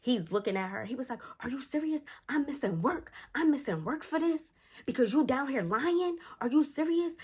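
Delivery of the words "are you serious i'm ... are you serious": high-pitched indignant rant; imitated stepdad fed up and snapping. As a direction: go off on the child in a much higher voice. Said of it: "started snapping on her".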